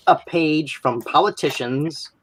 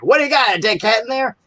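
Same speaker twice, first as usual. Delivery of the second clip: high mock Terminator thug; 10 semitones higher, faster than usual. Terminator scene guy taunting, shrill high mock yell. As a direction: pitch it way up and jeer through the door. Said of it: "It's like".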